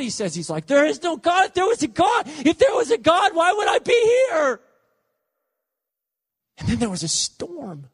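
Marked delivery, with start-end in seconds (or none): 0.7-4.6 high, anguished shout at God; blasphemous outcry. Retold or imitated. Tormented man rails at God last night, high anguished shout. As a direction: cry out in furious disbelief, voice shooting up. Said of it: "I was blaspheming God".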